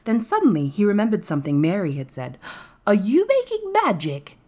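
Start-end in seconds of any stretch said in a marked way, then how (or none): 2.9-4.4 high, sharp asking; sharply questioning boy. A narrator's reading. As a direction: demand it sharply, pitched well up. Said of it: "he asked sharply".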